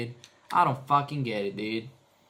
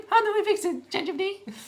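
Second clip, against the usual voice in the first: much higher, fast, sugary plea; mock sweet voice. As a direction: coax the chatbot in a cutesy falsetto.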